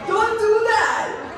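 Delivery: high laughing exclaim at her own past; laughing disbelief. Real, not acted. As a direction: laugh it off to the ladies in the room. Laughing at herself to audience.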